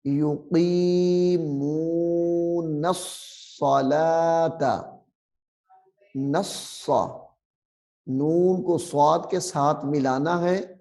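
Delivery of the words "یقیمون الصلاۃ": یقیمون الصلاۃ with ن merged into a hard doubled ص, slow; joined nasal with doubled ص. Shown as a demonstration. Teacher recites the merge slowly. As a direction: press the ن into the ص of الصلاۃ. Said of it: "سختی سے پڑھنا ہے".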